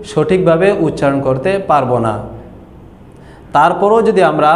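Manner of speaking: ordinary delivery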